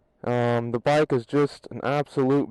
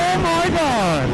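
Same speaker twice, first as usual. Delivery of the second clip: much higher, drawn-out cry of amazement; thrilled exclamation; slower than usual. Real, not acted. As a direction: let it rip, stretched out and high with awe. Thrilled by the acceleration.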